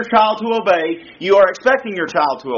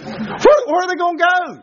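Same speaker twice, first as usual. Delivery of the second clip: incredulous; 10 semitones higher than usual. Real, not acted. Preacher mocking runaway threat.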